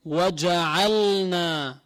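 وجعلنا slow, the lam clearly sounded; clear lam in waja'alna. Shown as a demonstration. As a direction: pronounce the lam of وجعلنا distinctly. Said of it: "حكمها الإظهار". (إظهار اللام في وجعلنا)

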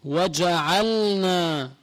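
وجعلنا very slow, lam distinct; clear lam in waja'alna. Shown as a demonstration. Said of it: "ركزوا عليهم". (إظهار اللام في وجعلنا ببطء)

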